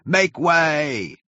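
high-pitched clearing call; crying out. A narrator's reading. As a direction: call out to part the crowd. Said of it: "cried the men all about him".

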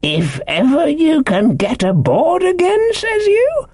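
high, slow castaway's startled echo; startled slyness. A narrator's reading. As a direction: repeat it slyly, pitched right up in surprise. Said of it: "perked up into a kind of startled slyness".

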